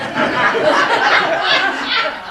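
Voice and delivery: bursting into a loud, high laugh. Real, not acted. Storyteller cracking up at his naive map plan, loud laugh. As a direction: let a big laugh explode.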